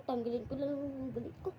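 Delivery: ordinary delivery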